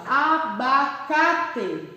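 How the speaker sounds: "Abacate" very slowly split as A-ba-ca-te; teacher sounding out "Abacate", final "te" fully pronounced; syllable by syllable. Shown as a demonstration. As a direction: separate each syllable of "Abacate" with small gaps. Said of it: "vamos separar a sílaba".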